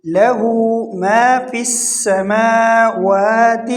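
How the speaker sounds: slow recitation, long aa's in السماوات; stretched madd. Shown as a demonstration. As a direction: hold the م and و vowels of السماوات. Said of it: "ایک الف کے برابر کھینچیں گے". (مد کو کھینچ کر تلاوت)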